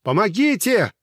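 high, loud, slow plea for help; desperate call nearly crying; near-tearful cry. A narrator's reading. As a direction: beg to be heard, close to tears. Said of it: "чуть ли не плача простонал".

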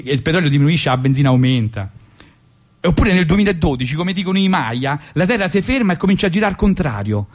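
normal talking voice

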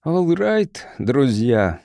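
usual speaking voice

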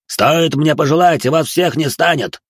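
threatening. A narrator's reading. Merchant threatens the group.

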